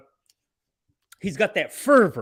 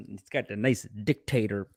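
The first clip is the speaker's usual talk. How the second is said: ordinary talk, nothing marked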